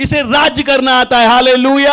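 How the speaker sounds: high, loud proclamation; rousing preaching climax. Real, not acted. Preacher declaring the boy can rule, rousing shout. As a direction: belt it out to the congregation.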